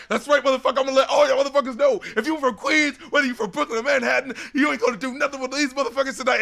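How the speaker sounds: fired-up retelling of rap bravado; hyped up. Retold or imitated. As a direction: jump in on a wave of imitated hype. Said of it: "Everyone's hyped up".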